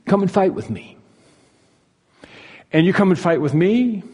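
lightly acted call to fight; mild mock yell. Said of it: "he's yelling and saying".

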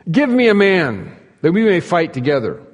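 lightly acted battle call; giant crying out. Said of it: "he stood and cried out".

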